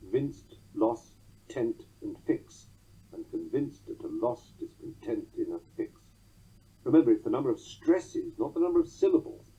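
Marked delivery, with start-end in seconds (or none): 0.0-2.7 "vinced, loss, tent, and fix" as isolated beats; stressed syllables alone. Shown as a demonstration. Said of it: "It has four stressed syllables". 3.1-6.0 "convinced at a loss, discontent in a fix" beating VINCED, LOSS, TENT, FIX; soft measured scansion of "convinced at a loss, discontent in a fix"; every third syllable stressed in "convinced at a loss, discontent in a fix"; four-stress beat. Shown as a demonstration. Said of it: "It has four stressed syllables".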